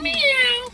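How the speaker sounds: high mock meow; cat meow. Prankster annoying an aggravated partner, mock cat meow.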